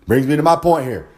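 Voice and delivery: ordinary talk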